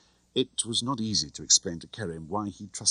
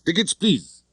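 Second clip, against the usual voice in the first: higher, louder mock ticket collector; loud official call; 11 semitones higher, 4 dB louder than usual. A narrator's reading. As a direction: bark it through the door like a conductor. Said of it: "I said in a loud voice".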